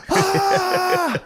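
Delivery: very high mock child squeal; toddler shriek. Child sent to room, very high squeal. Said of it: "There's like".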